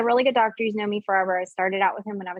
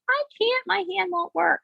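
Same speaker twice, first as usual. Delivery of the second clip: much higher, straining alarmed pitch; panicked alarm; 10 semitones higher than usual. Retold or imitated. Telling her husband she can't write, panicked. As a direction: sound scared your hand won't obey.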